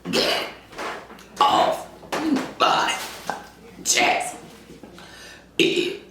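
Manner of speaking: words pushed out on a burp; burp-talk each word slowly. Real, not acted. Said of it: "In case you didn't hear what I said".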